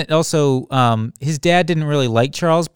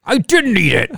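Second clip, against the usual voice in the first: higher-pitched, hammed-up denial; mock protest; 5 semitones higher than usual. Gaslit Cain denying he ate the quesadilla, mock protest.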